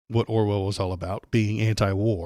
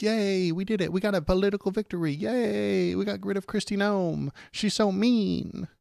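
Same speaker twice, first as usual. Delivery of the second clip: much higher, giddy mock cheer of smug Democrats; 10 semitones higher than usual. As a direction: squeal it like naive cheerleaders celebrating. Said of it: "They're gonna be like".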